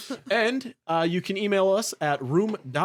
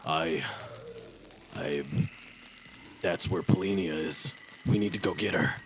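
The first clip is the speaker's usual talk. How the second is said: much lower, softer halting captain; gruff Captain Greyhound; 11 semitones lower, 7 dB quieter than usual. Acted. Game master voicing the captain to the party, low halting plea. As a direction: hesitate like a worried old soldier.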